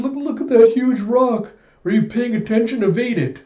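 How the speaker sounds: high, loud frantic shouting; panicked warning. Retold or imitated. York warning Alita about the boulder, panicked shouting. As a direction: yell a warning in mock panic. Warns driver of huge boulder.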